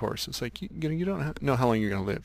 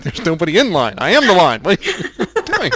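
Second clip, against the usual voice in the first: louder, higher "I am the line", cracking into a laugh; loud mock outrage at being sent back; incredulous protest; 8 semitones higher, 13 dB louder than usual. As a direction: protest the absurdity, half laughing. Man left alone protests in disbelief.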